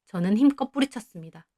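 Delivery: ordinary delivery, nothing marked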